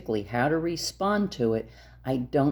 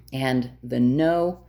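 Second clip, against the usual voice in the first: normal talking voice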